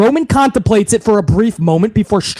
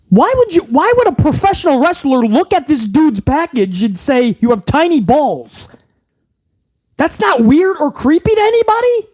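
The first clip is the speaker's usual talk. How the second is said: outraged disbelief; 6 semitones higher than usual. Real, not acted. Ranting in disbelief at writers.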